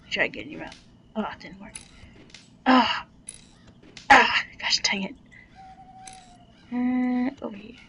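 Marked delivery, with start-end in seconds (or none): 2.7-4.4 loud sharp yelps; loud startled cries. Real, not acted. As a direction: yelp as you get hit. Yelps under attack.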